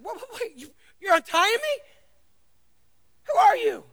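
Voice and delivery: high, rising, loud disbelief; startled. Acted. Tied donkey startled as two men untie him, high and loud. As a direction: stammer in shock at strangers.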